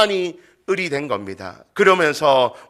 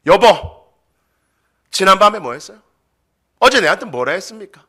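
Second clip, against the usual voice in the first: loud, higher acted accusation; quarrelling spouse; 5 semitones higher, 8 dB louder than usual. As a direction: confront your spouse, pausing between jabs. Acting a spouse starting a quarrel. (배우자를 다그치는 큰 목소리)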